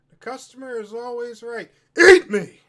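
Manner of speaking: polite shop voice snapping into a furious yell; mock retail voice. Shown as a demonstration. Joking about work, chirpy shop voice turning into a yell. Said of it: "That's my retail voice".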